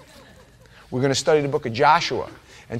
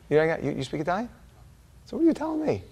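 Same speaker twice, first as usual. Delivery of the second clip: ordinary delivery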